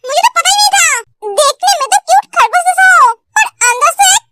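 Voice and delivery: huffy heroine lost for words; scandalised heroine. Acted. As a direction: scold him in mild indignation. Plays flustered scolding woman.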